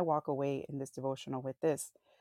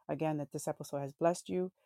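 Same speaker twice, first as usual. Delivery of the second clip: usual speaking voice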